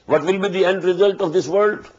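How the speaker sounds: normal talking voice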